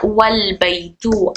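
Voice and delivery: slowly, joining वल straight into बैतू; slow demo. Shown as a demonstration. Teacher showing students the joined reading, slow and careful. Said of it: "अल बैतू से क्या हो गया?".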